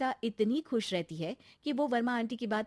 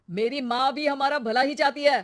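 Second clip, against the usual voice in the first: loud angry retort; shouting; 5 semitones higher, 9 dB louder than usual. Acted. Husband shouting at wife in defense of his mother. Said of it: "अरे पर चिल्ला क्यों रहे हो".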